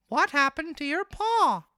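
very high, loud puppet voice; marionette voice. A narrator's reading. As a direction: pitch it way up as the naive little marionette. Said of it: "he asked".

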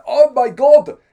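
high, loud outraged Rhodesian; Rhodesian accent. Retold or imitated. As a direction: exclaim it as an appalled Rhodesian colonial. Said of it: "Uh, accent".

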